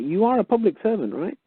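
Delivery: high, sarcastic questioning; mocking challenge. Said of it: "You write back and you say".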